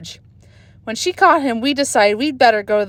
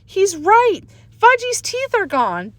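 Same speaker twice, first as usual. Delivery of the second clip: put-on distressed girl; 11 semitones higher, slower than usual. A narrator's reading.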